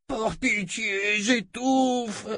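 high, drawn-out choking plea; slow, strained wail for mercy; crying out. A narrator's reading. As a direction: cry out as if suffocating, without volume. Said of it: "cria le fils de Darkenral".

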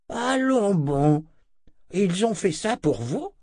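high-pitched, astonished reaction; incrédulité. A narrator's reading. Z incrédule devant la nouvelle. (étonnement incrédule, voix aiguë)

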